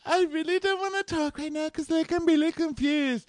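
very high put-on voice; squeaky mock-helpless pleading; mock girly voice. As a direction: imitate a reluctant girlfriend way up high. Voicing Amanda refusing to talk.

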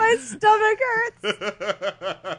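high, slow words squeezed out through laughter; laughing hard. Real, not acted. Friend breaking down over the show, laughing helplessly. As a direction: gasp it out mid-laugh. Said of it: "I'm laughing too hard".

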